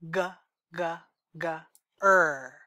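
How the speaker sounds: g g g then a long er; speech sound demo. Shown as a demonstration. Therapist modeling g, g, g, er for a child. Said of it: "So it's gonna sound like this".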